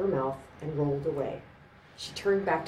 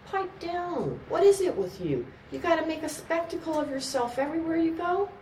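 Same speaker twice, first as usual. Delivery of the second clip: scolding bouncer; 14 semitones higher than usual. Acted. Bouncer scolding drunk woman.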